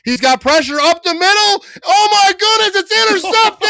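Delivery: high, loud disbelieving yell; excited shout. Real, not acted. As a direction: erupt as the ball is picked off. Real interception, fan erupts.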